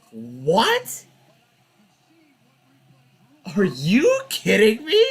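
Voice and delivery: indignant yell at the ref; outraged disbelief. Real, not acted. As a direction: protest the call in disbelief. Outrage at the call again.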